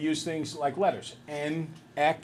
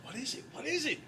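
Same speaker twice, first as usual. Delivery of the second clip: hushed, excited whispering; eager whisper; 6 semitones higher, 9 dB quieter than usual. Retold or imitated. Relatives crowding round the present, whispering eagerly. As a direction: whisper as if the whole room is leaning in. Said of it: "every person is, like, staring".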